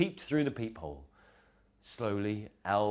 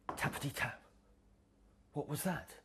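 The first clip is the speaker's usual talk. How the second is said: hushed tappity tap; whispered knock; 6 semitones higher, 7 dB quieter than usual. Acted. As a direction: quietly knock with the voice.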